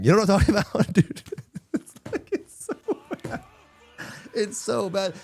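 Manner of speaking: breaking into laughter mid-sentence; giggling too hard to say it properly; laughing. Real, not acted. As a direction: laugh through the words, barely voiced. Laughing at his own photo.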